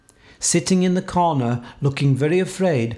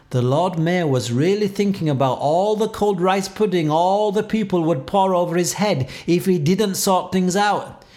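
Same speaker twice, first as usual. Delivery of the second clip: ordinary delivery, nothing marked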